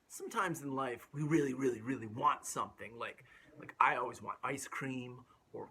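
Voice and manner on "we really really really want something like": ordinary delivery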